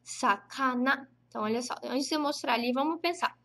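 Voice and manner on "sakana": slow, drawn-out sakana, each syllable sa-ka-na clear; teacher modelling sakana for students; model word. Shown as a demonstration. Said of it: "Peixe em japonês a gente chama de".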